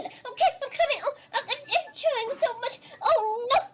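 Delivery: high, panicky squeak breaking into near-sobs; a tiny fish fretting and apologizing; flustered little fish. Acted. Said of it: "people keep, like".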